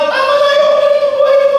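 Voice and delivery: very high, loud desperate cry; waking up screaming enough is enough; fed-up scream. Pretend. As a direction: scream it out like you've hit bottom. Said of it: "you will wake up na ano".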